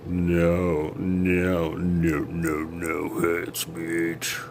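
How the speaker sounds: long drawn-out droning no's; slow-motion pretend objection; mock retraction. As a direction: stretch every word out slowly. Mock retraction of hate speech.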